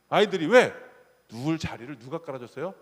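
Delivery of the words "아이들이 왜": ordinary talk, nothing marked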